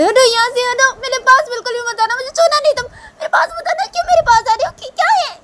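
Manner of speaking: very high, loud shouting; piercing frantic rejection; hysterical Shanaya. Acted. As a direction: scream at her to stay away. Shanaya orders Tisha away.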